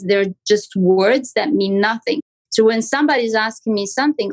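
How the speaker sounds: usual speaking voice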